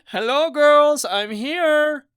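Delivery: high, drawn-out loud greeting; big singsong arrival call to the girls. Acted. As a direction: announce yourself loudly across a room, pitched high. Arriving man calls out to girls.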